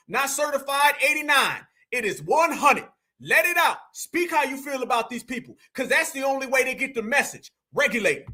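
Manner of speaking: usual speaking voice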